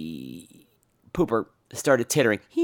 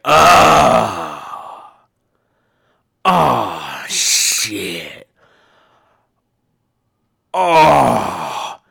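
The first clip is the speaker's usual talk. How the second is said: straining groans; 3 semitones lower, 7 dB louder, much slower than usual. Retold or imitated. Tin Man straining at urinal.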